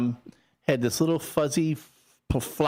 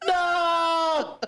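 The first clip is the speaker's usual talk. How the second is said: long, high hammed-up wail; melodramatic cry. Kid faking hurt from the slipper, melodramatic cry. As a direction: fake agony stretched right out. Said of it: "you had to put on the dra".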